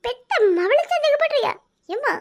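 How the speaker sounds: mock hurt. Daughter feigns hurt at mother.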